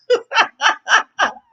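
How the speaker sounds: high, loud burst of laughter. Real, not acted. Laughing at scene.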